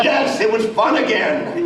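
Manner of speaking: high, drawn-out burst of excitement; retold elated reply. Voice actor retelling their reply to the agent, elated. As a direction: exclaim it high and slow, reliving the delight.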